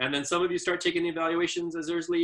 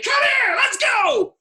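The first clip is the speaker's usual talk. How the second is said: sudden very high, loud shout; yelled; 9 dB louder, much slower than usual. Retold or imitated. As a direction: bark the order to end the standoff. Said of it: "And I yelled".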